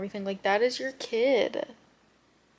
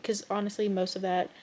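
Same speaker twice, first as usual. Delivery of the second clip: ordinary talk, nothing marked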